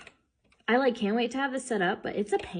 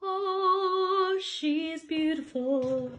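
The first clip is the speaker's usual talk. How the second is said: admiring gush; 9 semitones higher, 4 dB louder, much slower than usual. Real, not acted. Admiring finished table.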